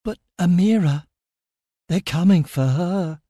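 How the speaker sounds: high-pitched, anxious news; fearful warning. A narrator's reading. Stellos tells narrator they're coming for Amira, fearful.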